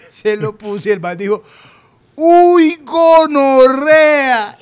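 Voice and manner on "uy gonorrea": loud, drawn-out high cry of shock; shocked outburst. Retold or imitated. Man seeing his ruined haircut cries out in shock. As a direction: blurt it out in horror at the mirror.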